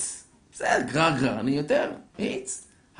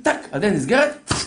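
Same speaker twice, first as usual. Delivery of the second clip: usual speaking voice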